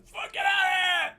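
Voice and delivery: high screamed shout at a tiger; acted-out piercing scream. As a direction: scream it to scare off a beast. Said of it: "and I screamed".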